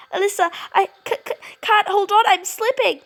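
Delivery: high, fast, breathless panic; panicked cry. Acted. Jane slipping toward the abyss, crying out in high fast panic. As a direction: gasp it out while losing your grip.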